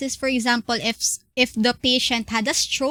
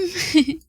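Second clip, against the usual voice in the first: short bright laugh; 6 semitones higher, 4 dB louder than usual. Real, not acted. Laughs after the host interrupts.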